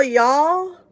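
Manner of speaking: y'all called out loud and high in a playful Southern twang; a hammed-up Southern y'all; mock Southern y'all. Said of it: "I'm not even Southern".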